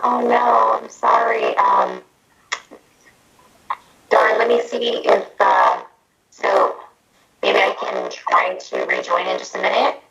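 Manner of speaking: heavy reverb, muffled mic; like a hand over the mouth; talking into a trash can. Real, not acted. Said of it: "a lot of reverberation happening".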